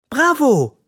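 delighted cheer, high and loud. A narrator's reading. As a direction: cheer and clap. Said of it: "ruft Mathilda und klatscht".

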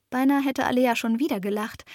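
usual speaking voice

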